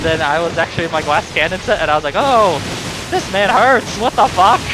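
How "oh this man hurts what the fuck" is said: higher, louder retold alarm at taking big damage; shocked disbelief. Player retelling his own shock at nearly dying in a fight, alarmed. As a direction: yelp it out as the hits land.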